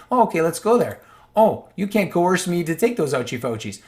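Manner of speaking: loud, high, sarcastic "oh okay"; mock sarcastic delight. Answering the officials, loud and mockingly delighted. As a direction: play the gleeful citizen catching them out.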